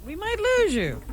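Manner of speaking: insistent repeat. Real, not acted. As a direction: push it up high so he listens.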